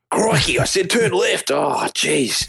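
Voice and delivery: put-on exasperated GPS in extreme Aussie accent; high, drawn-out broad Australian impression; Steve Irwin. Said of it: "We need a Steve Irwin voice".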